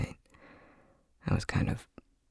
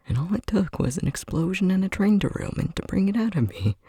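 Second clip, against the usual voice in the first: high, sardonic disbelief; sarcastic exclamation; 12 semitones higher, 4 dB louder than usual. Acted. Marvelling wryly at the disaster to friend, pitched high. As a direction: throw the absurdity up high.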